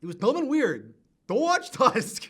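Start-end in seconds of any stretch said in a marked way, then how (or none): 1.3-2.3 loud, slow, high final verdict; drawn-out loud plea to skip the film; emphatic warning. Real, not acted. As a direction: stretch each word out as a warning. Closing verdict to listeners.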